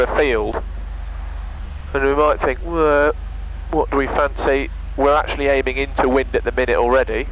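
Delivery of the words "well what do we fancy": ordinary talk, nothing marked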